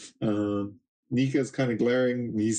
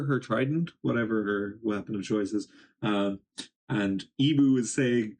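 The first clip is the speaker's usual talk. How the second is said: ordinary talk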